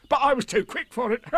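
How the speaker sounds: high, boastful tiger voice with a laugh; bouncy storybook tiger; Tigger. A narrator's reading. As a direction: brag high, then chuckle. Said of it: "said Tigger".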